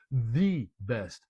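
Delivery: "the" stressed and said as "thee"; emphatic THE. Shown as a demonstration. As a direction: set "the" apart as a definitive "thee". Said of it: "It's not the kind of".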